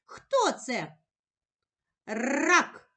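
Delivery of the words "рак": "Рак" with a long rolled р at the start; emphasised r. Shown as a demonstration. Teacher helping a child locate the r, emphasised. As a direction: roll hard into "Рак". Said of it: "Де ми чуємо звук р".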